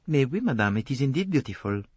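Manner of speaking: Poirot's courteous French lilt; French accent. A narrator's reading. Poirot voiced by the narrator.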